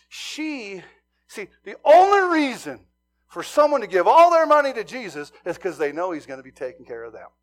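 loud, high, insistent preaching; preaching at full force. Real, not acted. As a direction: drive the point home. Preaching climax.

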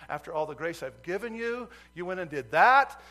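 God's reproach, voiced with growing force; indignant rebuke. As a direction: scold in disbelief, rising to a loud finish. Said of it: "fire indignation of the Lord on me saying".

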